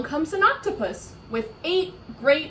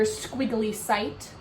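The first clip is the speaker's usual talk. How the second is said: ordinary delivery, nothing marked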